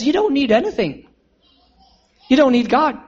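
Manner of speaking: usual speaking voice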